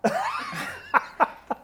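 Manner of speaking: high, real laugh; burst of laughter. Real, not acted. Host cracking up at the ironic nickname, high laugh. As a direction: laugh out loud at your own joke.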